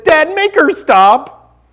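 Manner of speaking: high, drawn-out mock wail; stretched-out, high-pitched childish pleading; upset child's plea. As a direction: imitate an upset little boy begging his father. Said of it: "He's crying. He's upset".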